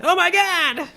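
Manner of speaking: high-pitched startled exclamation; jump-scare scream. Shown as a demonstration. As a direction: act out a sudden jump scare. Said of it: "a jump scare is supposed to be that".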